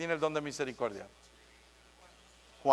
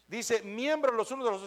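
ordinary talk

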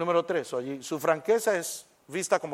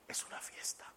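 unvoiced conspiratorial whisper; whispered aside; 20 dB quieter than usual. Shown as a demonstration. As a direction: let them in on the secret. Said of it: "whispered aside to audience". (susurrando al público)

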